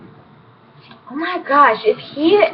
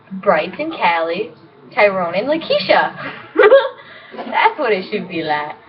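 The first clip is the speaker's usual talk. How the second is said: ordinary delivery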